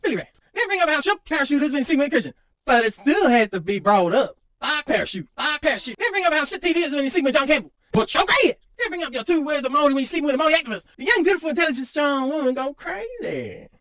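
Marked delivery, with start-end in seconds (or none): none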